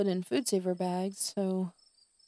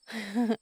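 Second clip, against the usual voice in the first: short awkward giggle; a quick self-conscious chuckle; nervous laugh; 3 semitones higher than usual. Real, not acted. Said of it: "I do this weird nervous laugh".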